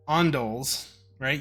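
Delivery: normal talking voice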